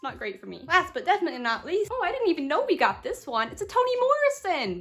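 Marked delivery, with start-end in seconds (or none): 3.5-4.8 excited. Real, not acted. Excited reveal.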